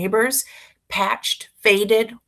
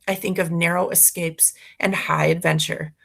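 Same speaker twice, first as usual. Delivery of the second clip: ordinary delivery, nothing marked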